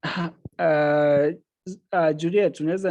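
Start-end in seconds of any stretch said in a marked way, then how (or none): none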